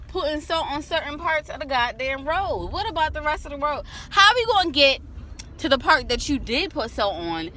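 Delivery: heated, high-pitched rant about the road; angry rant. Real, not acted. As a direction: vent loudly at the city. Ranting about unsalted road.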